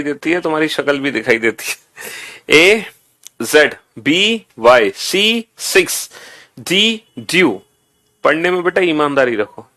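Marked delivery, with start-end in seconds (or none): 2.6-7.5 very slow, drawn-out drill chant; slow call for pupils to read along; lead group recitation. Shown as a demonstration. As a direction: stretch each letter so the class can join in. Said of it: "मेरे साथ भी पढ़ के सुनाओ".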